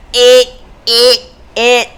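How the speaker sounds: stiff, level robot-like repetition; imitating robot. Mother showing how her son plays robot, robot imitation. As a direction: imitate a robot in short, even bursts. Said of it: "This is what my son's doing".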